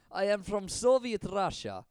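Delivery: slow, lightly put-on Russian accent; a hammed-up stab at a Russian accent; attempted Russian accent. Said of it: "I swear I've done it before".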